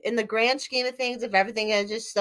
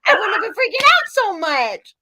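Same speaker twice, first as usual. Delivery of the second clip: amused; 10 semitones higher, 4 dB louder than usual. Real, not acted. Laughing at herself.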